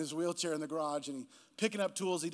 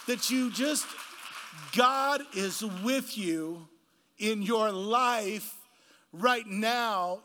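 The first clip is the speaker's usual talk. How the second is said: slow, weighty preaching, raised pitch, building in force; emphatic preaching; 4 semitones higher, slower than usual. Real, not acted. Preacher drives the story's lesson home to the congregation, emphatic preaching.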